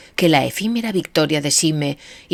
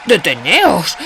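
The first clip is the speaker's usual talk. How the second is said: shouting; 2 semitones higher, 4 dB louder, slower than usual. A narrator's reading.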